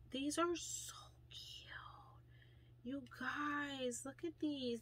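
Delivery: awed whisper over cute earrings; gushing whisper. Real, not acted. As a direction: whisper it like a treasured secret.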